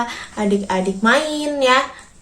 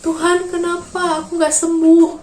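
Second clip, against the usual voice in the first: higher, tearful plea to God; crying king; 7 semitones higher than usual. Retold or imitated. Teacher voicing the sick king crying to God, higher and tearful. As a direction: cry it out as the sick king begging. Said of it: "katanya gitu ya".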